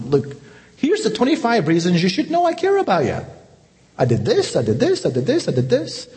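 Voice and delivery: high-pitched mock lecture listing reasons; hammed-up list of I did this; mock lecturing. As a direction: parody a defensive lecturer. Mock lecture Jesus doesn't give.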